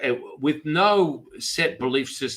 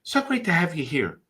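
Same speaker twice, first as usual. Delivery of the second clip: imitating a delighted TV host; Oprah; 8 semitones higher than usual. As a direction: stretch out a gushing greeting to the guest. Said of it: "then she's like".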